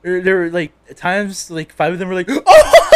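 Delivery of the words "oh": very high, loud whoop; loud group-laughter imitation. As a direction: mimic rowdy friends bursting out laughing. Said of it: "start laughing out loud".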